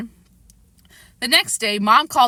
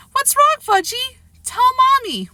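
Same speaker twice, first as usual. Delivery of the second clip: mother's comfort; 5 dB louder than usual. A narrator's reading. Mom comforts crying child.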